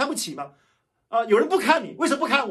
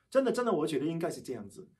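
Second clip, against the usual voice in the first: ordinary delivery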